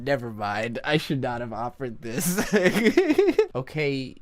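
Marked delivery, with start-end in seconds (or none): 2.6-3.5 high, loud burst of laughter; sudden high giggle; embarrassed laugh. Real, not acted. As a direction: crack up at your own failed attempt. Laughing at own failed accent.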